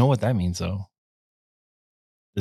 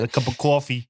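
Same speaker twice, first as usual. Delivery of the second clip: put-on New York accent; 3 semitones higher than usual. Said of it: "that New York accent".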